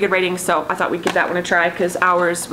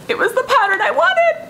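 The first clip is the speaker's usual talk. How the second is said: high excited squeal. Real, not acted. Delighted reveal of lucky purchase.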